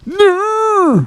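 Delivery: very high, drawn-out comic no; mock high wail. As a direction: squeal it out on one held high note. Mock outburst after garbled word.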